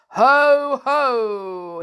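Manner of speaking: loud, high, drawn-out hail; wizard's ho-ho. A narrator's reading. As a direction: boom it out like a storybook wizard. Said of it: "he said".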